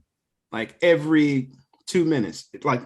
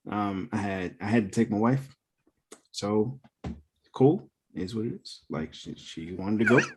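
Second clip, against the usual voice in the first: trailing lower and softer, as if embarrassed; voice sinking lower; 4 semitones lower, 5 dB quieter than usual. Real, not acted. Admitting he took his wife, voice dropping lower and softer. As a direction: let each phrase sink quieter and deeper. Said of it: "did you hear how his voice got progressively lower".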